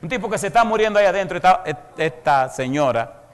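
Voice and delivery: loud, high, fast indignant burst; indignant outburst. Real, not acted. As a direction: blurt it out in disbelief. Predicador indignado por la esposa. (estallido indignado y alto)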